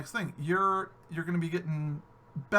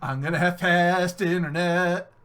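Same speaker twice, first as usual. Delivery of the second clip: slow, gleeful daydream; imagining work day waiting for fast internet; dreamy anticipation; 4 dB louder, slower than usual. Pretend. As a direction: savour it like a treat. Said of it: "You'll be sitting there going".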